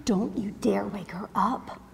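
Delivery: soft, stern whisper; warning whisper. Acted. Mother to husband beside the sleeping baby, warning whisper.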